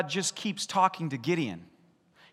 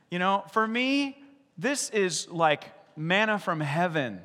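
usual speaking voice

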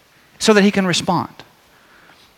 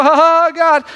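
mock nervous laugh; 9 semitones higher, 3 dB louder than usual. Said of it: "to go".

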